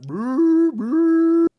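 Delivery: loud high train noise. Retold or imitated. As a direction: shout like a steam train. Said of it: "going to mimic a train".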